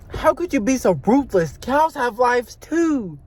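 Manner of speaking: hammed-up reproach, last words drawn out; mock outraged scold. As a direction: plead the cows' case in mock outrage. Imagined stranger scolds him for eating beef.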